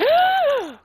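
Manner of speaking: loud high gasp of sudden idea; sharp excited gasp. Retold or imitated. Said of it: "And then I'm like".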